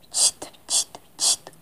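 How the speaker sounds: soft unvoiced chit to a cat; whispered shushing. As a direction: hush the cat gently. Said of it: "hacía la señora".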